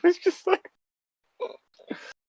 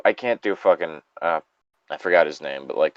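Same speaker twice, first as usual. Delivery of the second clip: normal talking voice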